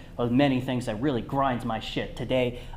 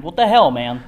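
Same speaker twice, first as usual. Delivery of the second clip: angry; 3 semitones higher, 11 dB louder than usual. Acted. Comic argument resumes.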